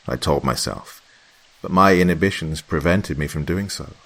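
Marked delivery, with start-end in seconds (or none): none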